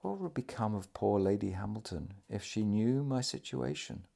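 soft, hushed question; subdued voice of a dying man; low tone. A narrator's reading. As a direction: speak it quietly, as a worried aside. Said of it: "he added in the same tone".

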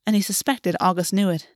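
plain reading voice

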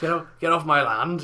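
mock landowner shouting at kids; grumpy old man shouting. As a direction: bellow it off the porch. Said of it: "shouting from my fucking window".

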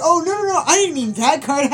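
panicky Joey backpedal. Retold or imitated.

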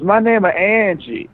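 high, slowed put-on voice; mock waitress. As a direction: introduce yourself like the waitress. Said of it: "she goes".

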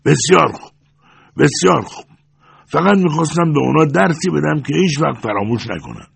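high, irritated outburst; vexed, high-pitched retort; angry cry. A narrator's reading. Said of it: "با عصبانیت فریاد زد".

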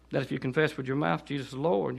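normal talking voice

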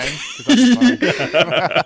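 slow laughing high voice. Real, not acted. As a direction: laugh through the words. Laughing while joking.

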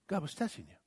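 ordinary talk, nothing marked

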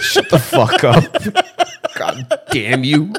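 slow, drawn-out cursing through laughter; helpless laughing outburst; laughing protest. Real, not acted. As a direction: curse your friend out while cracking up. Teased about his nuggets, cracking up.

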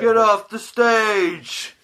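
high, very stretched-out mock yell; playful drawn-out heckle; heckling shout. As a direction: drag each word out like a jeering crowd member. Acts heckling a stage show.

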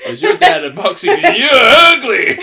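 loud, high, laughing shout; mock insult yell. Host flips co-host's pickup line, shouted laughing insult.

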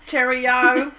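loud playful Cockney cheerio; mock Cockney. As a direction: ham up a Cockney goodbye. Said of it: "very Cockney accent".

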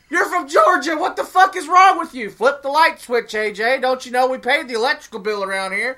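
shouting at the TV in disbelief; exasperated yelling. Retold or imitated. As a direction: berate him like a fed-up parent. Yelling at wrestler on screen.

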